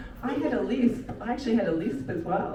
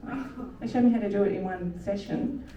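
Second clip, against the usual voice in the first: ordinary delivery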